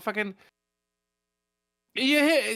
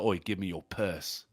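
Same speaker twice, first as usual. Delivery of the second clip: low, softer menacing demand; British pub mugger; 9 semitones lower, 6 dB quieter than usual. Acted. Mugger demanding a purse from a ponce, low British menace. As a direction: play a menacing British street robber. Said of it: "you'd be like".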